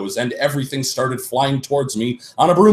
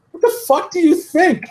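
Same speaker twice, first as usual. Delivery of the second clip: very high, loud furious retort; angry snap; 5 dB louder than usual. A narrator's reading. Heroine snaps at her worried bandmate, angry. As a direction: spit it back in outrage. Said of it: "I asked angrily".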